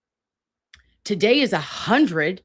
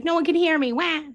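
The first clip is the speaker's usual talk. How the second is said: higher, whiny childlike wail with a fake Wah; hammed-up baby crying; mock baby whine; 7 semitones higher than usual. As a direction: play a fussy newborn who feels ignored. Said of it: "He's like".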